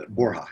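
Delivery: "Borja" as BOR-hah; official pronunciation. Shown as a demonstration. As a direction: say "Borja" with an h sound for j. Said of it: "is the official pronunciation".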